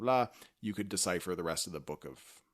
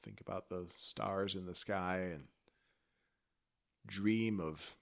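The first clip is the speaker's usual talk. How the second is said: soothing bedtime voice; 8 dB quieter, slower than usual. Pretend. Lulling bedtime send-off.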